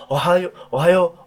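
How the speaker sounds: おはよう with は pushed out as a breathy exhale; exhaled ohayou. Shown as a demonstration. Teacher showing the breath-spitting おはよう mistake. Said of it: "って吐いちゃうんですね".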